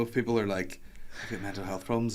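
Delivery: ordinary talk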